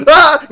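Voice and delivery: loud, high yelled "Ah!"; loud, high mock battle yell; high battle cry. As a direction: let out a high charging yell. Mock battle cry after quote.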